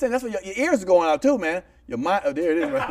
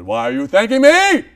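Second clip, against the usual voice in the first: slow, loud, raised-pitch bellow; booming demand; 6 semitones higher, 12 dB louder, slower than usual. Retold or imitated. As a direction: thunder the question like an indignant goddess. Mother Nature demanding of the golfer.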